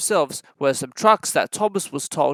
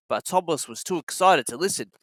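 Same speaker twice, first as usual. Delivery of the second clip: ordinary delivery, nothing marked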